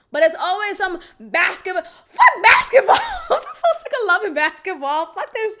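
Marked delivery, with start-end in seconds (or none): 2.1-5.6 much higher, loud shout with laughter; laughing shout. Real, not acted. Ranting about his basketball to viewers, laughing shout. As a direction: yell it, fed up and cracking up.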